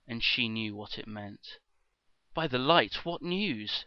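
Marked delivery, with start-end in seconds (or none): 2.3-3.8 loud, high anxious cry; urgent shout. Acted. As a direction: call out in alarm. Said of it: "she shouted".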